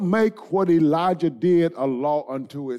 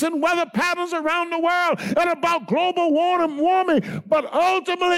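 much higher, ringing shout-preaching, slowing on "it is God"; full-force preaching; 8 semitones higher than usual. Acted. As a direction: lift the church up with each phrase. Preacher climbs to a peak.

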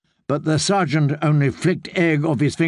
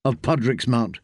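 usual speaking voice

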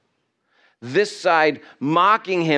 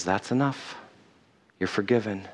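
soft forgiveness; 6 semitones lower, 8 dB quieter than usual. Acted. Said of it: "And Jesus says".

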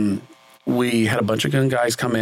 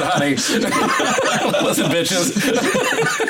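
higher, drawn-out joke line through laughter; laughing mock threat; 10 semitones higher, much slower than usual. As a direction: crack up while playing tough. Joking mock quote amid shared laughter.

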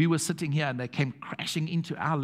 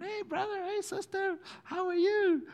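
very high, drawn-out welcome; hammed-up sing-out hello; effusive greeting. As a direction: greet them like long-lost family, voice way up high. Acting out effusive welcome to couple.

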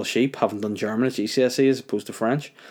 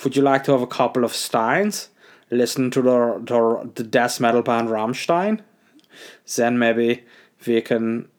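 sexually inappropriate German guy; hammed-up suggestive German come-on; camp German accent; 2 semitones higher than usual. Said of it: "sexually inappropriate German guy".

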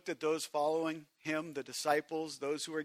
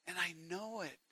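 quiet; 8 dB quieter than usual. Acted. Repeated softly after loud take.

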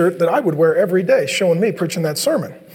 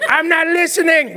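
put-on higher, bratty kid voice with a laugh; 8 semitones higher than usual. Kid refusing to listen to parent, bratty and laughing.

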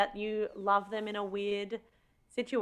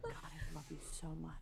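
hushed passionate; 3 semitones lower, 19 dB quieter than usual. Retold or imitated. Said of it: "being like".

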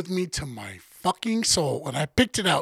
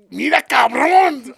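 loud, drawn-out higher-pitched yell; imitated mom's scolding; 7 semitones higher, 7 dB louder, slower than usual. Imitating mom cursing out the kids, loud scolding yell. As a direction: holler it out long, imitating an angry mother. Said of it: "She gave us the old fucking".

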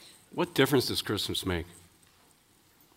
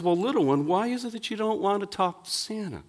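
put-on high, sugary talking-to-a-child voice; coaxing newscaster; 8 semitones higher than usual. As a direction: coax a small child, pitched up. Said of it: "he says".

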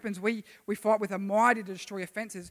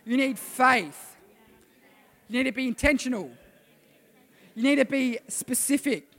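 emphatic declaration; 2 semitones higher, 8 dB louder than usual. Shown as a demonstration. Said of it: "Tell them".

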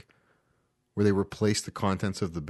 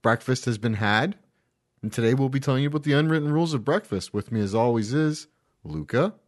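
show host intro; 4 semitones higher, 5 dB louder than usual. Acted. Hosts announce the show intro.

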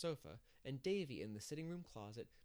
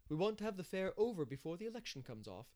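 lightly voiced busybody, higher and louder; busy organiser; 7 semitones higher, 5 dB louder than usual. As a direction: press the point in a lifted voice. Narrator voices Mrs Lynde.

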